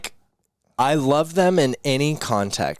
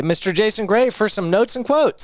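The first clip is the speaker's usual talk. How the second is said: announcer voice; 9 semitones higher than usual. Pretend. Announcer intro of co-host.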